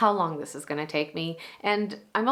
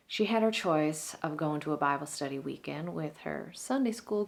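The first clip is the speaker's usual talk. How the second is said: lightly played, concerned monologue with long thoughtful pauses; a touch of wistful jealousy under understated, caring gossip; Truvy. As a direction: puzzle it over with caring, mild judgment, some lines dropping near a whisper. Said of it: "It is True V in Act Two from Steel Magnolias".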